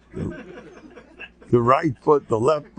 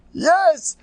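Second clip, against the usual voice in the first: put-on child's eager yes; 5 dB louder than usual. Kid confirms.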